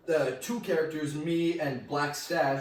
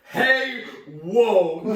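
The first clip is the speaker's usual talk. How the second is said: raised, louder mock alarm; imitated alarm; 4 semitones higher, 6 dB louder than usual. Everyone turning round at the accent slip, imitated alarm. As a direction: act out people stopping someone short. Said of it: "Everyone turned around and was like".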